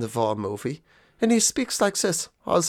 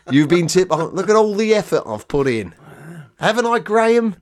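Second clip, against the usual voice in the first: smug English retiree; 5 semitones higher than usual. Retold or imitated. Retiree boasting to his friend, mock smug and pleased. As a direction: ham up a pompous retiree tipping the waiter. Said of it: "like, as if".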